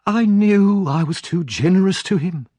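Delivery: high, loud martyred woman; self-pitying wife. Acted. Missus Sowerby laments her generosity to Mister Bumble, high and martyred. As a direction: sigh to heaven about your own kindness. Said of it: "raising her eyes to the ceiling".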